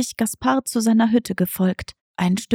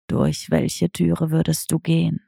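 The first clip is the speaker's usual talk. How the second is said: understated lower cat voice; tomcat Diego asking; 5 semitones lower than usual. Acted. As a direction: ask like a sly old tomcat. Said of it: "fragte Diego".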